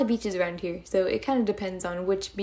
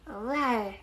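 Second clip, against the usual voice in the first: ordinary talk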